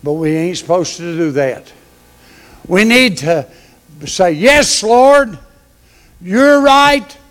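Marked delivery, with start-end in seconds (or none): 4.3-7.2 higher, louder fervent surrender; fervent yes Lord. Retold or imitated. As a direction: cry out your agreement to God. Preacher voices submissive prayer.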